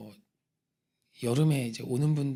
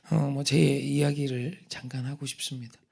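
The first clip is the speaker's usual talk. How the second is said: ordinary delivery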